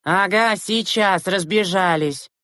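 high, drawn-out jeer; sarcastic retort. A narrator's reading. Children brushing off the driver, sarcastic. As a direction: drag it out mockingly.

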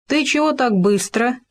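a touch of brusque curiosity; unceremonious. A narrator's reading. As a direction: ask it bluntly, voice lifted. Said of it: "бесцеремонно спросил Хома".

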